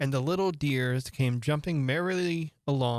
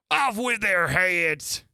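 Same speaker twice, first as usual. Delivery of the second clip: shouted sentence; 5 semitones higher, 6 dB louder, much slower than usual. Acted. Queen orders execution.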